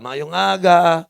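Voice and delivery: loud, drawn-out Bisaya greeting; slow, booming mock Bisaya accent. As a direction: stretch it out in a hammed-up Bisaya accent. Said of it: "Pag Bisaya ka".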